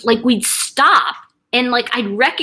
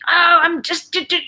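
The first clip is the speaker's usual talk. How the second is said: higher, stammering mock upset; upset father; 9 semitones higher than usual. Daughter mimicking her upset elderly dad, flustered. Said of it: "he got really into upset".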